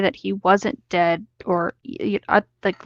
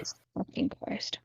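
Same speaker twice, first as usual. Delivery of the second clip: whispered curse; 16 dB quieter than usual. Real, not acted. Exasperated aside.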